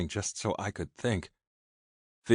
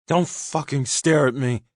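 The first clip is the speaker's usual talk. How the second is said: loud, higher, furious snap; angry shout; 8 semitones higher, 8 dB louder than usual. A narrator's reading. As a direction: yell it, unable to bear his stare. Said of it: "still doing there shouting".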